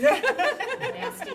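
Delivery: high, slow laughing word. Real, not acted. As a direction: laugh it out at yourself. Laughing at herself.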